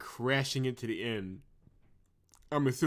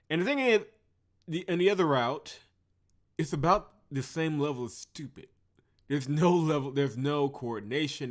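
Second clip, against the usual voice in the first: exasperated rant; 5 semitones higher, 6 dB louder than usual. Real, not acted. Ranting about stupid route.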